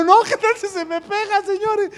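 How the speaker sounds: high-pitched worried protest; mock alarm. Moses objects to putting his hand back, mock alarm. Acts Moses balking at God's order.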